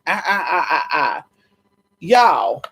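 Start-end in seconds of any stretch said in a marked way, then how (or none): none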